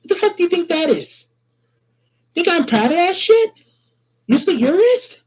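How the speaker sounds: high, heated, accusing; angry accusation. Real, not acted. Caller angrily accusing the other man of lying about his wrist. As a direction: go after him, indignant.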